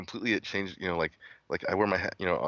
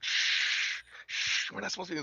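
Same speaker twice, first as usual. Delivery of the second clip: hushing; 11 semitones higher, 4 dB quieter than usual. Retold or imitated. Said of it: "I'm just like".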